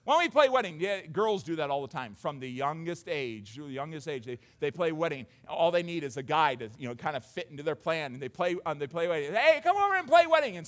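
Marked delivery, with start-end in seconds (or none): 0.0-0.8 put-on high, loud kid calling playmates over; pitched-up playground call; calling over friends. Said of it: "calling over their friends". 9.3-10.5 put-on high, loud child's invitation; acted playground shout; calling playmates.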